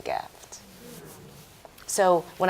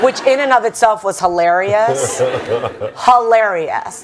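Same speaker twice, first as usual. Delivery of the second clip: real laughter, louder, spilling into the words; laughing; 10 dB louder than usual. Real, not acted. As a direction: laugh out the memory. Laughing at the memory.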